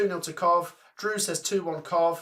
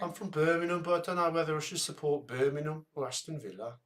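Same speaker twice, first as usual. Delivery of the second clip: softer, badly put-on Birmingham drone; Brummy accent; 5 dB quieter than usual. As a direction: impersonate a Brummie, poorly. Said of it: "it's sort of, like, slow".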